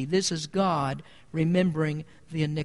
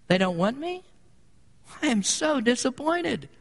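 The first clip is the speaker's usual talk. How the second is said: sarcastic, higher, whiny mock lament; mocking weak God; 6 semitones higher than usual. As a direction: parody a helpless, self-pitying God. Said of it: "as some preacher would say".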